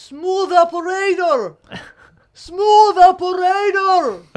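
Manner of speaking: very high, long-held heckler's yell; imitated drunken yell. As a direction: stretch each word out like a wasted festival heckler. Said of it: "איזה מישהו מתחיל לצעוק".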